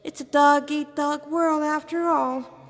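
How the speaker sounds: flamboyant demon lieutenant. Acted. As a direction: show off your rank to the cornered humans.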